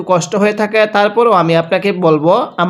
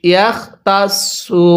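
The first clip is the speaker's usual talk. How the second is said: ordinary talk, nothing marked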